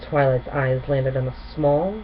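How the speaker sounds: ordinary delivery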